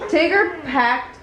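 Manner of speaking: ordinary delivery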